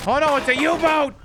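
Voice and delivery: high, loud hammed-up panic; mock alarm. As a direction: cry out in fake horror. Mock alarm at game scene.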